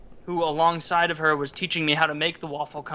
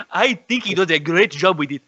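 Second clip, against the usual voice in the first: put-on deliberate, caricatured African accent; put-on African accent, comic testimonial; mock African accent; 2 semitones lower, 5 dB louder than usual. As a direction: answer as a character in a hammed-up African accent. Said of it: "uh, Mukumbe?".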